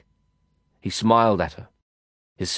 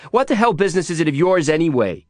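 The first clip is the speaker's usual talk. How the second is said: high, heated challenge; indignant retort; 7 semitones higher, 3 dB louder than usual. A narrator's reading. Girl telling someone off for prying, indignant. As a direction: fire it back, offended and high-pitched.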